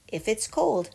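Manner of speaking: usual speaking voice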